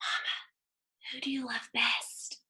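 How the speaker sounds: hushed secret whisper. Acted. Boy asking his mother who she loves best, whispered. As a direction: whisper close to her ear. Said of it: "He whispered".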